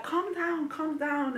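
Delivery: high mock-soothing calm down; calming her belly. Scene: telling her shouting chili-filled belly to settle, high mock soothing. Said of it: "and you're like".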